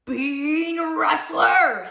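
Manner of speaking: slow, drawn-out loud roar; roaring bear. A narrator's reading. Riled great big grizzly accuses the thief, roaring. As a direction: roar each word as an accusation. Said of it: "he roared".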